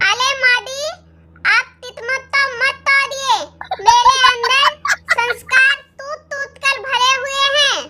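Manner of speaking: "अले मादी", "तितमत", "तूत तूत" with r to l and k to t; childish lisp through every word; lisping baby talk. Acted. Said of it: "ये तोतली बहू".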